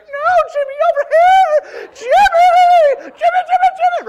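very high, loud mock yelling; shrill laughing shouts; calling out. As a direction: scream to trick the dizzy kid. Rival teams misdirecting Jimmy.

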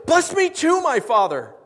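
a wail of betrayal to his father; anguished plea, pitched high and loud; loud bitter cry. Acted. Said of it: "he cried out with a loud and bitter cry".

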